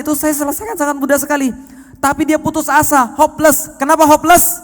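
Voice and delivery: high-pitched, insistent sermon delivery; impassioned preaching. Real, not acted. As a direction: build to a loud, incredulous challenge. Preaches God's offence at despair.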